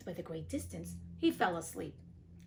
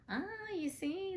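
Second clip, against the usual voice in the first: high, slow marvelling "ah, you see"; drawn-out awed exclamation; wondering ah; 11 semitones higher, 3 dB quieter, slower than usual. Real, not acted. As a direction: marvel at the power of prayer. Preacherly aside on prayer.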